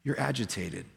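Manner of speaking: softly, gently drawing her closer; a quiet, tender invitation. Retold or imitated. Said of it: "with all the grace in the world".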